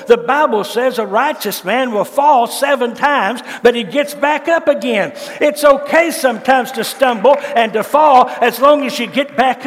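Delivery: rising revival shout; fiery preaching. Acted. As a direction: preach it hard to the congregation. Preaching at full force.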